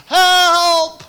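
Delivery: high, loud desperate yell; shouting for help far out at sea; cry for help. Retold or imitated. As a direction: scream it across the water. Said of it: "And he cried out".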